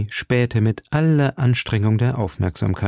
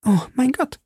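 high, startled cry of alarm; high frightened outcry; 14 semitones higher than usual. A narrator's reading. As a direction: gasp it out in sudden fear. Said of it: "rief Madame Bonacieux".

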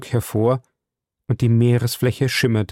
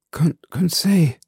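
slow, whispery, higher-pitched stammer; 7 semitones higher than usual. A narrator's reading. Man coming round calls his servant, faint stammer. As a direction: falter weakly on the name. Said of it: "stammelte ich".

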